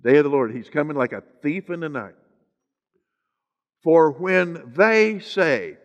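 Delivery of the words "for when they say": THEY stretched out long and hit hard; slow right down and lean on "they"; stress on THEY. Real, not acted. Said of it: "now with emphasis on the word they".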